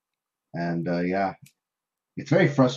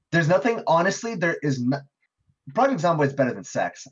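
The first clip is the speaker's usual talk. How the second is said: excited; 8 semitones higher, 4 dB louder than usual. Real, not acted. Heated defense of favorite game.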